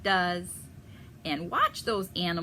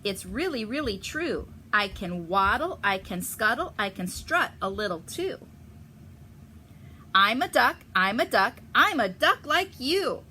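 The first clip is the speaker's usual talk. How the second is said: loud, higher, singsong boasting; stranger pleading; 3 semitones higher, 8 dB louder than usual. Acted. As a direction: show off every duck trick. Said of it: "says the stranger".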